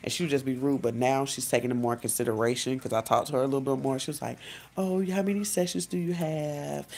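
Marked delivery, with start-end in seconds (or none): 4.8-6.9 lightly voiced sweet, higher mom; a hint of an overly nice mother. Mom in the car, mildly put-on sweetness. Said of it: "try to be all extra quiet".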